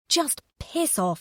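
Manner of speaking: high, slow, fed-up curse; muttered curse. A narrator's reading. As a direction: spit it out under her breath. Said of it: "thought Martha".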